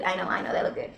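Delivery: breathy whispered repeat of the boast. Real, not acted. Whispering a boast about her nails. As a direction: whisper it to yourself smugly.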